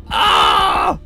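high, loud scream of fright; frightened scream. Real, not acted. Player startled by the scary game, screaming. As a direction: scream out as if jumped.

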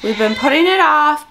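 ordinary delivery

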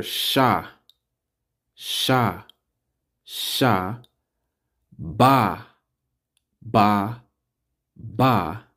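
sha, ba, ta sounded out slowly one by one; teacher sounding out sha ba ta; syllable by syllable. Shown as a demonstration. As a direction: break Shabbat into its syllables sha, ba, ta.

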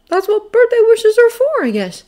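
put-on high young voice, falling at the end; high-pitched, tentative hope; wishful boy. A narrator's reading. Peter shyly admits his wish.

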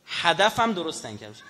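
normal talking voice

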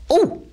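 high, sharp "oh!"; loud, sudden high exclamation; startled cry. Real, not acted. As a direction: yelp in surprise. Startled during game.

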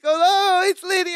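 very high, hammed-up relief; mock delighted greeting. Co-host acts Larry greeting Lydia, mock delight. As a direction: squeal the greeting in imitation.

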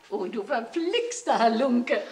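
normal talking voice

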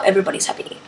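soft whispered echo. Real, not acted. Repeating her happy line to viewers, whispered. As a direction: breathe it like a private aside.

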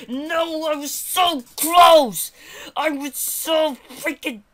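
real loud, high outburst; anguished, loud rage; frustrated gamer yell. Real, not acted. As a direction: yell in agony at a near miss. Player fails near the end.

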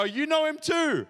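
high, loud, drawn-out call; gleeful retort called out loudly; excited exclamation. Retold or imitated. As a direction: exclaim it with a soaring, stretched pitch. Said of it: "turn around and go".

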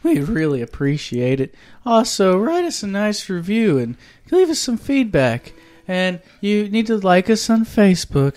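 chipper ad read. Acted. Pitching iTunes ratings.

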